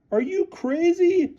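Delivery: very high slow coach voice; shouted. A narrator's reading. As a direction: shout it in disbelief. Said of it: "shouted Flynn".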